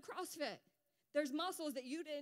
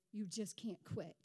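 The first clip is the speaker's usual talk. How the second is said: ordinary talk, nothing marked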